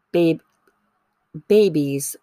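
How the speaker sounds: catching on the first syllable; stuttering. Real, not acted. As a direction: stutter on babies. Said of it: "I'm just stuttering".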